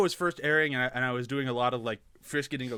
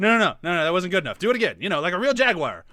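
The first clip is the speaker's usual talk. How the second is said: loud, higher, insistent demand; badgering; 4 semitones higher, 7 dB louder, faster than usual. Acting the candidate demanding a better roar, pushy. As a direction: badger them into another try.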